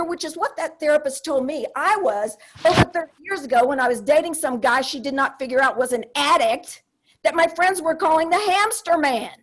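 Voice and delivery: normal talking voice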